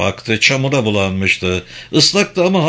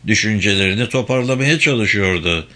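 ordinary delivery, nothing marked

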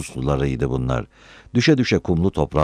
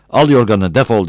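loud, raised angry dismissal; shouting; 6 semitones higher, 7 dB louder than usual. A narrator's reading. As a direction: throw him out with a yell. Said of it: "diye bağırdı".

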